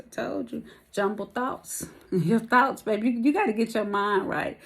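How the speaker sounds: ordinary talk, nothing marked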